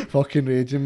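loud laugh bursting through the words. Real, not acted. Joking to his mate about his lost touch, laughing loud.

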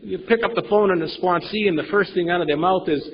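normal talking voice

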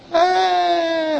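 high, drawn-out mock laugh; re-enacted laugh. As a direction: act out a long high "hey" of amusement. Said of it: "I laughed".

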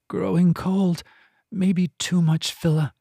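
high, anxious murmur of a healer; worried healer. A narrator's reading. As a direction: diagnose it with dread. Finta examines dying cat worriedly.

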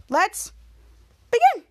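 high, loud launch of the show; kick-off call. Real, not acted. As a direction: punch it high to start. Announcing the start of recap.

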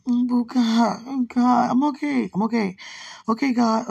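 overwhelmed, steadying herself through rising emotion; emotional outburst. Real, not acted. Alone at night, overcome while talking to God.

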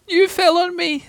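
very high small voice; squeaky, aggrieved Piglet. A narrator's reading. Piglet telling Pooh who he landed on, squeaky.

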